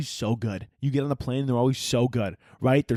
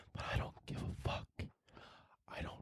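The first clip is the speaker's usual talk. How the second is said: hushed whisper; whispered aside; 19 dB quieter than usual. Pretend. Host on crying children on planes, whispered aside. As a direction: whisper it like a secret. Confides he does not care.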